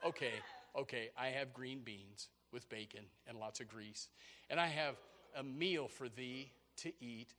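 soft, unruffled host; the Lord. Acted. God serving a meal amid enemies, soft calm voice. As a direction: keep listing the food quietly and calmly. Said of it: "And the Lord says".

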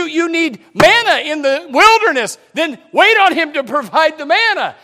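loud, high-pitched preaching build; full-force preaching. Acted. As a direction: thunder it from the pulpit.

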